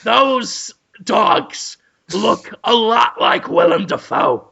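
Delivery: slow, emphatic villain voice; Cruellum Dafoe. Pretend. Villain explains the difference to doubters, slow pompous delivery. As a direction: declare it grandly, word by word. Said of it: "but I am Cruellum Dafoe".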